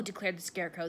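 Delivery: usual speaking voice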